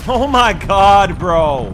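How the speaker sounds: slow, loud exasperated oh my god; loud drawn-out groan. Real, not acted. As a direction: stretch it out in frustration. Frustrated gamer outburst.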